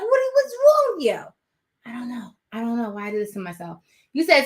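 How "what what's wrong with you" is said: much higher, exasperated cry. Real, not acted. Owner exasperated at her bonkers puppy, high. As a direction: throw up your hands at the dog.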